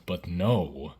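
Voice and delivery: usual speaking voice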